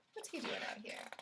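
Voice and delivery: put-on hushed whisper to the kids; conspiratorial storytime whisper; mock whisper. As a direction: whisper it like a secret to the audience. Aside to kids about rhino.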